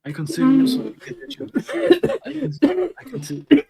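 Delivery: laughing through the words. Real, not acted. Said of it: "I consider Sonic Adventure filler".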